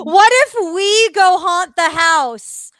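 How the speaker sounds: loud, high burst of excitement; excited scheming. Real, not acted. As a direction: pitch the prank with giddy urgency. Excitedly pitches a prank to friends.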